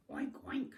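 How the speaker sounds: two short piggy oinks. Acted. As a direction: grunt like a storybook pig. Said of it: "grunted the".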